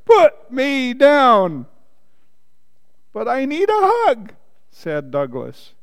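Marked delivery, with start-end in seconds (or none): 0.0-1.7 high, loud, drawn-out order; shouted demand. A narrator's reading. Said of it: "shouted the rabbit". 3.1-4.3 high-pitched plaintive storybook plea; pleading. A narrator's reading. Bear pleads with rabbit.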